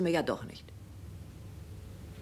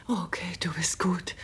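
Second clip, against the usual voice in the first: whisper. Acted. Wife answering her friend's question, whispering. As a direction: breathe it with a tired irony. Friend confides husband's affair.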